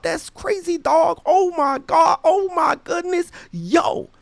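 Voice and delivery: high-pitched imitation of an amazed crowd; mock amazement. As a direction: squeal like starstruck friends firing questions and exclamations. Retold crowd amazed at him.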